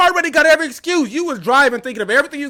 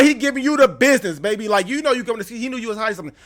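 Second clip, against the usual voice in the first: ordinary talk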